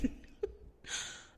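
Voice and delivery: breathy half laugh, half sob; a soft crying laugh; tearful laugh-cry. Real, not acted. Host breaking down about her husband, laugh-crying. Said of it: "I did not think I was gonna cry".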